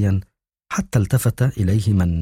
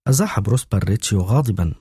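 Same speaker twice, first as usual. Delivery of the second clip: plain reading voice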